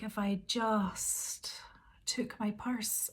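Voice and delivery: slow, voiceless and soft; regretful whisper. Acted. Thinking back on leaving her purse, slow whisper. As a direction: whisper the regret to yourself, slowly.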